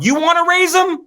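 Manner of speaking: high-pitched mock outraged shout; indignant yell. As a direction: throw it at the cop in shrill indignation. Mock confronting a cop.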